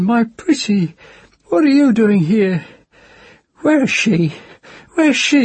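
high, loud frightened crone; an old hag begging the child away; old witch voice. Acted. Cursed witch warns the child not to come near, high and frightened.

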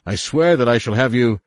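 pompous sputtering colonel. A narrator's reading. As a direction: bluster like an outraged colonel. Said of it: "the general blustered".

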